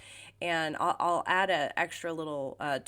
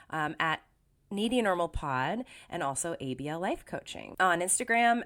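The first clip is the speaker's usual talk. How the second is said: ordinary talk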